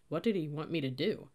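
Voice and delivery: plain reading voice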